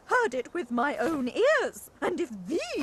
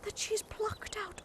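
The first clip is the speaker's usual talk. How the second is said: scandalised whisper; gossip whisper; 8 semitones higher, 9 dB quieter than usual. Acted. Gossiping hen whispering the scandal to her friend. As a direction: whisper the juicy part.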